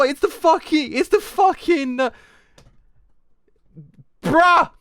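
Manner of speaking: loud, high, blown-away shouting; real shouted amazement, easing slightly by the end; hyped disbelief. Real, not acted. Fan realises it was foreshadowed all along, shouting in disbelief. Reacts wildly to twist.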